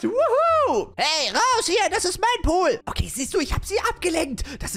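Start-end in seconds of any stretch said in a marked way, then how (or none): 0.0-2.8 high, loud cheer then telling off; loud indignant fairy; shrill shout. Acted. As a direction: shoo them out of her pool. Fairy guards her pool.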